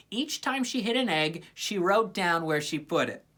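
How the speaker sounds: ordinary delivery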